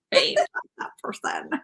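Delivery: whispered creepy echo; mock creepy whisper. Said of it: "That's such a creepy voice".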